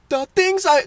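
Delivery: Ed. Acted. Friend aiming for Courage and landing on Ed, high cartoon voice. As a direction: attempt a high cartoon character voice. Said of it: "I'm doing Ed".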